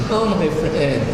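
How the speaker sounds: usual speaking voice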